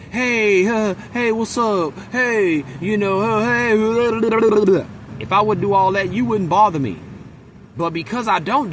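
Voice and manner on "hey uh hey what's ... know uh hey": high, gushing mock greetings; fake friendly. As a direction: put on exaggerated chumminess, pitched way up. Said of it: "switched it up on you and just".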